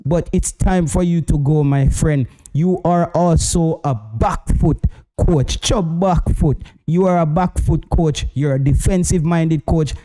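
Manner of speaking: normal talking voice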